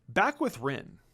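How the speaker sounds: usual speaking voice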